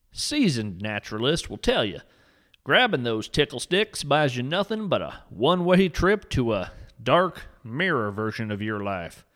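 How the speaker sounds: usual speaking voice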